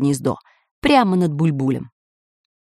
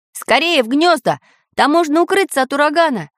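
higher urgent command voice; 9 semitones higher than usual. A narrator's reading. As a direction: bark the order to climb. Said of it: "скомандовал Самоделкин".

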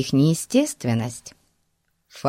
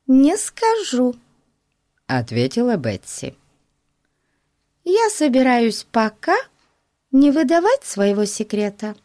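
put-on girl voice; 8 semitones higher than usual. A narrator's reading. Betsy voiced.

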